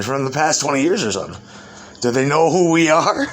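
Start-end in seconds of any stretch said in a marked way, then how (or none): 2.0-3.2 higher, louder mock indignation; amused outrage; incredulous retold question. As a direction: blurt it out in disbelief, voice raised. Mocking retold question, pitch jumps.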